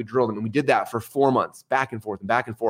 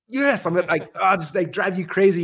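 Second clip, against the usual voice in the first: pitched-up, half-laughing exasperation; high frustrated teen reply with a laugh; exasperated teen; 7 semitones higher than usual. Retold or imitated. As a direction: blurt it out, fed up and a bit embarrassed. Teen answering church friends after loss.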